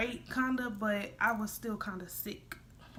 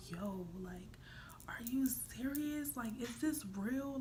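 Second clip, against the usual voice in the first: whispered disbelief; 8 dB quieter, slower than usual. Retold or imitated. Inner disbelief thought.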